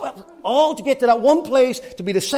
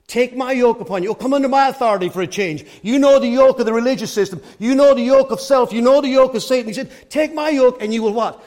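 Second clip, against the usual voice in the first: loud, raised, driving preacher's call; full-force preaching; 3 semitones higher, 7 dB louder than usual. Real, not acted. Preacher urging the congregation to take Christ's yoke, full-force preaching. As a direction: preach it out loudly to the room.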